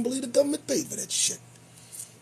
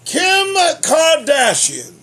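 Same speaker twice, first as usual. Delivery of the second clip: announcer; 8 semitones higher, 9 dB louder, slower than usual. Acted. Announcing headline.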